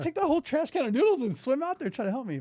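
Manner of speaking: incredulous rant at his wife; exasperated. Retold or imitated. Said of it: "I go".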